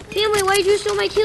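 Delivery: high, loud, fast complaint; real outraged whine; indignant yell. Real, not acted. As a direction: shout at the kill-stealer. Complaining at teammate.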